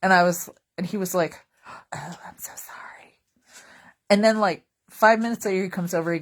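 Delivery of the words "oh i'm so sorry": whispered apology; hushed sympathy. Retold or imitated. New coworker apologizing in a hushed whisper. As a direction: whisper it sorry and sheepish.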